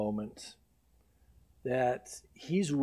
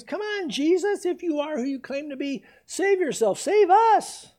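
high, loud jeering taunt; mocking criminals; 12 semitones higher, 7 dB louder than usual. Criminal on the cross taunting Jesus, high and jeering. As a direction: sneer it up at him from the cross. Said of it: "saying the same things".

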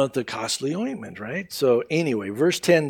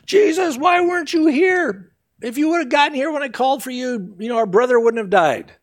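a grieving sister blaming him, fast; distraught Martha; 9 semitones higher than usual. Acted. Martha runs out and reproaches Jesus, high and rushed.

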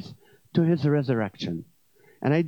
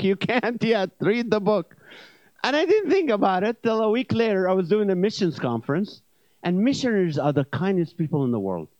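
ordinary delivery

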